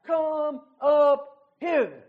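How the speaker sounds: loud, slow come up hither; crying out. Acted. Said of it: "he cries out".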